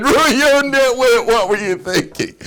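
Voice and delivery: very high, loud mock shriek, drawn out; hammed-up furious wife's outburst; explosive scolding. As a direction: erupt after hours of holding it in. Wife finally explodes at husband at night.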